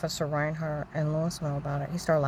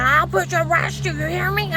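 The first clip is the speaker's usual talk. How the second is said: very high, loud re-enacted yell; imitated screaming; 14 semitones higher, 10 dB louder than usual. Man threatening to arrest the speaker, screaming. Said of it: "He starts screaming. He said".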